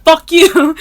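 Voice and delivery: high, loud mock shouting, laughing through it; hammed-up angry Philly local; mock Philly yell. Imitating how Philly people yell at friends, loud and laughing. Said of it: "people in Philly are like".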